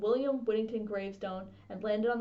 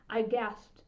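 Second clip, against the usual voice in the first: usual speaking voice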